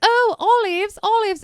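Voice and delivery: high, hammed-up fake Australian accent. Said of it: "this fake Australian accent".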